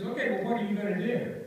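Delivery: a mild mock dare; challenging attorney.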